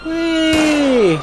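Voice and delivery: high, loud gleeful squeal; gleeful whee. Acted. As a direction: squeal with joy as you jump. Leaping off the building.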